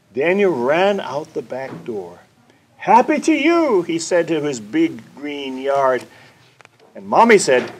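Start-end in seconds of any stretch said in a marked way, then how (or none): 2.8-3.8 high, loud happy call. Acted. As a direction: call it out across the yard. Child calling out to yard.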